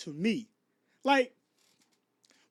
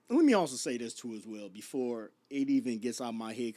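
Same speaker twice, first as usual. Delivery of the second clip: usual speaking voice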